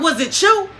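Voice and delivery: furious grandma confronting the room; grandma storming in, yelling; grandma going off. Retold or imitated. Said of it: "my grandma was hot".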